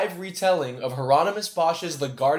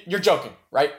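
normal talking voice

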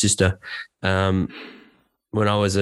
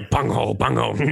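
cartoon teen voice. Shown as a demonstration. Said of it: "I can do a few".

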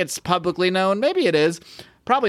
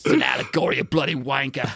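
mock Australian. Jokey Australian accent voicing Alan Moore as a bloke. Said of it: "making him Australian".

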